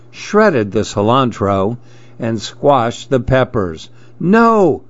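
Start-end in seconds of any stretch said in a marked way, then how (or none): 4.2-4.8 high, sharp cry of "No!"; high-pitched storybook outcry; cried out. A narrator's reading. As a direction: cry out in dismay as a story character. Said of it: "cried Dev".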